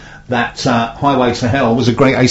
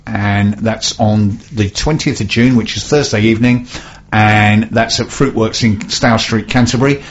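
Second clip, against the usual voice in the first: normal talking voice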